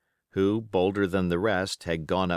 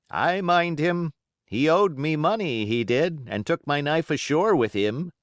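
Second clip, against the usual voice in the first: a high wheedling seaman grumble; Morgan; 7 semitones higher, 4 dB louder than usual. Acted. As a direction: pitch up as a whining old sailor. Morgan voiced higher.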